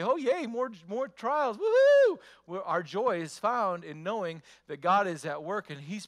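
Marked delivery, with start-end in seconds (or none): 0.0-2.2 high, loud hammed-up woohoo; mocking giddy cheer. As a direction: fake celebrate the trials. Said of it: "We're not to say".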